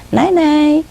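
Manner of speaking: high, drawn-out goodnight call; parrot copying owner. Retold or imitated. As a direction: sing out a high goodnight as the bird. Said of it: "Exactly like my voice".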